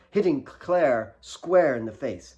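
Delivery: usual speaking voice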